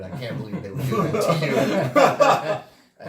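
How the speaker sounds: gloating through fake pity; fake sympathy, laughing. Faking sympathy to the fired coworker, laughing. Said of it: "happily told him".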